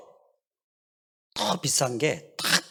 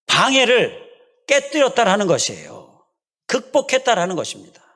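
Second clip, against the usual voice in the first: higher, louder preaching push; rising, emphatic sermon climax; preaching emphasis; 7 semitones higher, 6 dB louder than usual. Acted. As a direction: drive the point home from the pulpit.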